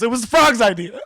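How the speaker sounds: high, loud mock-frantic excuse; hammed-up alarmed tattling; panicked blame-shifting. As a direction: squeal on someone else in a hurry. Retold rat shifts the blame.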